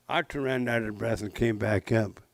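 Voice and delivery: plain reading voice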